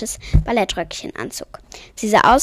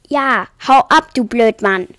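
loud, indignant telling-off; raised-voice scolding; 7 dB louder than usual. A narrator's reading. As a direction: hurl it after him crossly. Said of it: "schimpft Lubinchen".

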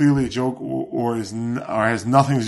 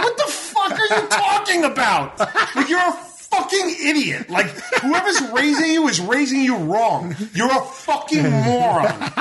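much higher, laughing tirade; laughing mock rant; 11 semitones higher than usual. Telling off a kid on Twitter, laughing and high. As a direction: cackle out the insults, pitched way up. Retelling reply to a young fan.

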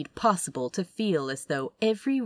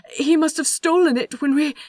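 loud, high, rushing babble; flustered suspect pleading loudly to the boss; panicked outburst; 10 semitones higher, 7 dB louder than usual. Acted. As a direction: blurt it out in a panic. Panicked babbling under interrogation.

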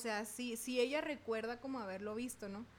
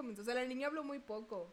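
normal talking voice

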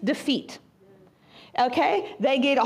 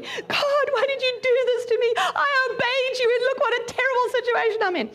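moaning Joshua; 8 semitones higher than usual. Retold or imitated. Preacher plays Joshua moaning to God, higher and self-pitying. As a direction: whine that you obeyed and got punished. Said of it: "Joshua is moaning to the Lord".

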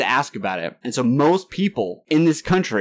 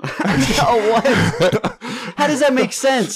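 high, laughing disbelief; 11 semitones higher, much slower than usual. Real, not acted. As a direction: laugh through the words.

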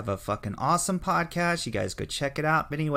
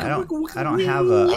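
sudden high loud squeak; squeaky high voice; 7 dB louder than usual. Pretend. Trying to make soundtrack noise.

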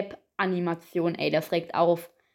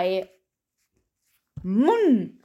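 ordinary delivery

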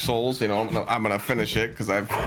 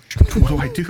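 loud panicked gasp of shock; 7 semitones higher, 8 dB louder than usual. Retold or imitated. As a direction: blurt it out in sudden panic. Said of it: "I'm like".

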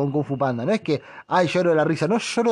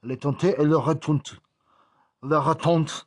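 mock French. Shown as a demonstration. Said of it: "en Francia".

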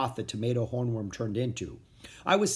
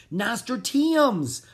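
excited cry; 9 semitones higher, 4 dB louder, much slower than usual. A narrator's reading. Excited kid spots favorite flower.

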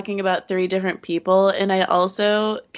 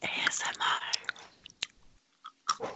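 mock ASMR whisper; 13 dB quieter, much faster than usual. Whispering during a joke mukbang.

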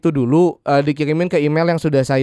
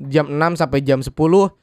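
ordinary talk

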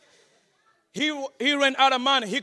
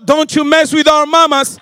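ordinary talk

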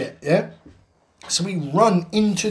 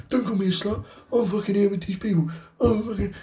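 lightly voiced higher-pitched protesting girl; mock girlish protest; retold indignant girl; 5 semitones higher, 5 dB quieter than usual. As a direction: imitate a girl arguing back, pitched up. Girl argues back in queue.

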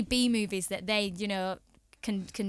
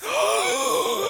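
shocked gasp; 9 semitones higher than usual. Real, not acted. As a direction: gasp as if scandalised. Mock shock at disliking Star Wars.

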